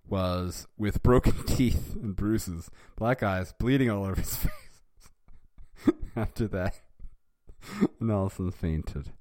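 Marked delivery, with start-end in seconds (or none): none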